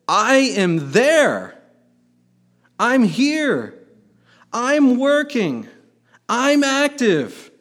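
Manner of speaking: high, loud and slow divine reply; God's reassuring voice. Acted. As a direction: declare each word slowly, from above. Preacher voices God answering Habakkuk.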